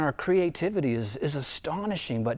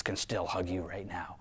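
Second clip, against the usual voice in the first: soft, breathy whisper of reassurance; tender whisper; 8 semitones lower, 11 dB quieter than usual. Real, not acted. Preacher comforting isolated listeners, tender whisper.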